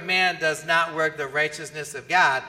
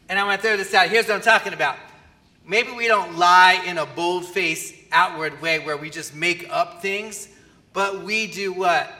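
ordinary talk

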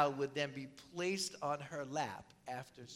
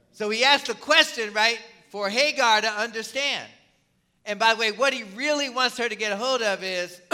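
louder, higher, urgent preaching; 6 semitones higher, 11 dB louder than usual. Real, not acted. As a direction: press the point home to the congregation. Preacher stressing God's care.

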